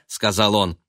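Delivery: ordinary delivery, nothing marked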